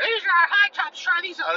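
squeaky high mock salesclerk voice. Imitating a shoe clerk pushing ankle-high shoes, squeaky high. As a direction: pitch way up, mimicking a pushy clerk. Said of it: "you know, like".

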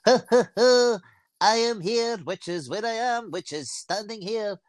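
high, drawn-out comic impression with a stagey laugh; Chick Young impression. Mimicking a comedy show's running character, high and drawn out. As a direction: open on high ha-ha-ha, then slow down, high-pitched. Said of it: "he would always go".

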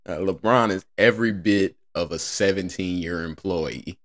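ordinary talk

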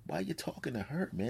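ordinary talk, nothing marked

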